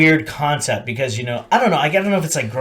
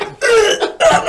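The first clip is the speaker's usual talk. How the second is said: high, loud grunting; hammed-up effortful groans; mock strained grunts; 6 dB louder than usual. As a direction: grunt as if winded and struggling. Imitates struggling after being hit.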